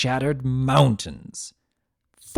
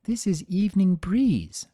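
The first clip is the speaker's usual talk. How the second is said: a higher voice for the mother introducing her foal; higher voice showing off the new daughter; Ember's voice; 8 semitones higher than usual. A narrator's reading. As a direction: lift the pitch up high to voice the mare.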